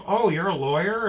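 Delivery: high, loud surprised woman; surprised gym lady. Retold or imitated. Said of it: "she's like".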